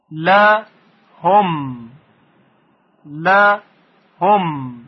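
«لهم» split into «ل» and «هم» with a pause; firm «ل», pause, falling «هم»; syllable by syllable. Shown as a demonstration. Teacher drilling «لهم» in two parts, syllable by syllable. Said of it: "با روش بخش تفکیک".

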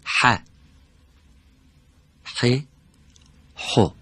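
ح said with different vowels, from the throat; letter drill. Shown as a demonstration. Teacher to studio students, leading the vowel drill. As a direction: clear slow drill of ح with each vowel.

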